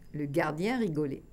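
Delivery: ordinary delivery, nothing marked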